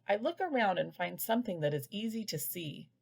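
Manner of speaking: ordinary delivery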